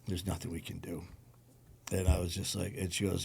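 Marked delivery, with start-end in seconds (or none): 0.1-1.0 soft, low, understated retelling of a tearful doctor; retold mild tearfulness. Doctor to patient's friend in the hall, mildly tearful. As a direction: drop the voice right down, imitating quiet grief. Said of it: "she starts crying a little bit".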